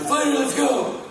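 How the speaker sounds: high, loud imitation of a rallying cry; Reedus rallying. Friend calling everyone out to the bull, imitated excited yell. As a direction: holler it to gather the gang. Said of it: "Reedus is like".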